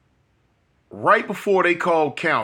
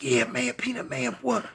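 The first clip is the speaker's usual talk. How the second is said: whispered greeting; 9 semitones higher, 6 dB quieter than usual. Retold or imitated. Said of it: "We gonna whisper anyway".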